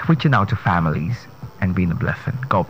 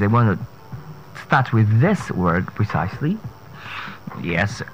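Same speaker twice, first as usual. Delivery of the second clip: ordinary talk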